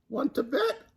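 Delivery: high mock scream of a dare. A narrator's reading. Nightingale daring Alligator, high mock scream. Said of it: "screamed Nightingale".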